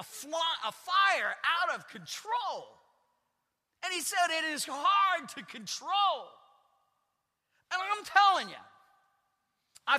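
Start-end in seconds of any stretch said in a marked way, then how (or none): 0.0-6.3 louder, slower, higher cry of fire out of control; preaching shout. Real, not acted. Pastor warning the church about the tongue, preaching shout. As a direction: let it blaze from the pulpit.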